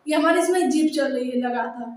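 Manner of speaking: ordinary talk, nothing marked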